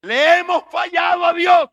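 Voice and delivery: loud, high preaching cry; preaching shout. Real, not acted. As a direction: proclaim it at full force. (grito de predicador)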